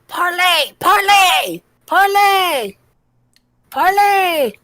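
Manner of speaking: high squeaky shouted plea; squeaky kobold shout. Acted. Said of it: "you hear a squeaky voice say, shouting the word".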